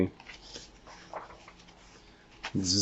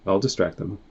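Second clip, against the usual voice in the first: ordinary delivery, nothing marked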